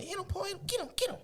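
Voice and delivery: high mock cheer of little sisters. Sisters cheering the boy against his stepfather, high mock cheer. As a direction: egg him on in a high voice. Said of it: "They cheering me on".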